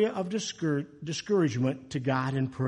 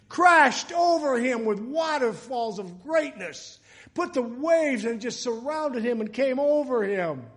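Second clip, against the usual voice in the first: raised, high preacher's exclamation; fervent preaching; 7 semitones higher, 4 dB louder than usual. Real, not acted. Preacher telling how waterfalls crashed over David, loud and fervent. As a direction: proclaim it big, stretching the words. Dramatizes God's overwhelming answer.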